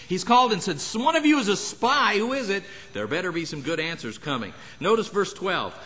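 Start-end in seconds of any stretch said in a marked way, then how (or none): 1.0-2.6 demanding loudly, pitched high; a king furious at his servants, demanding the spy; enraged king. Retold or imitated. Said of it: "The king is enraged".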